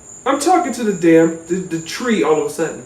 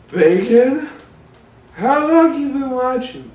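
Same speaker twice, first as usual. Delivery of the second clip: bewildered stoned self; 7 semitones higher than usual. Retold or imitated. Narrator questions the tree.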